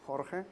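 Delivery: "Jorge" said softly as "HOR-heh", a throaty h at the start; Spanish pronunciation. Shown as a demonstration. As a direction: say "Jorge" with an h sound, not a j. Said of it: "with H".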